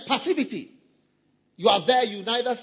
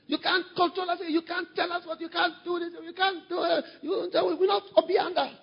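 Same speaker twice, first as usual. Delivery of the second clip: a hint of a high rebellious voice; lightly voiced defiant protest; mock defiant member; 10 semitones higher than usual. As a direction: mimic the stubborn crowd, pitched up. Mimics rebellious members again.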